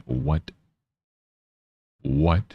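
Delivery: ordinary talk, nothing marked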